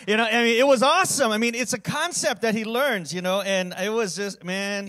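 loud, high burst of joy; overjoyed. Real, not acted. Pastor telling the congregation about his son, loudly thrilled. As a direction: let the delight spill out loud.

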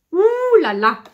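high loud delighted exclamation; excited gasp. Real, not acted. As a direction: burst out in wonder. Opening the gift.

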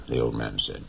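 ordinary delivery

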